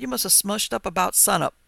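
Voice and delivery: ordinary delivery, nothing marked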